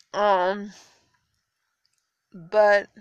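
usual speaking voice

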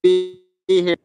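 choppy, breaking-up phone line; call dropping out. Real, not acted. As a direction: voice cutting in and out. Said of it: "You, you are breaking up a bit".

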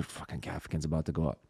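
low, very soft and quick; hushed grumble. Retold or imitated. Comic texting buddies from backstage, hushed grumble. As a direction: grumble it fast under your breath. Retold text to friends backstage.

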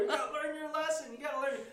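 very high, hammed-up old-man holler; moped vigilante. Vigilante on a scooter in hot pursuit, hammed-up high voice. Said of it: "I call him the moped vigilante".

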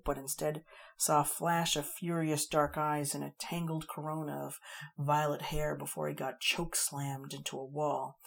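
ordinary delivery

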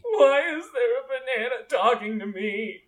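high, hammed-up crying; hysterical sobbing. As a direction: wail it through mock sobs. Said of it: "Starts sopping hysterically".

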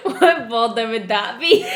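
barely holding back laughter; asking the friend, cracking up; laughing disbelief. Retold or imitated. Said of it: "and I was like".

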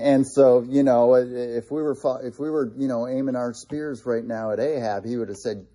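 usual speaking voice

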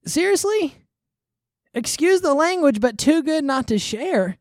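high, loud incredulous outburst; exasperated disbelief. Real, not acted. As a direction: throw the phrase back, appalled. Exasperated at the quoted phrase.

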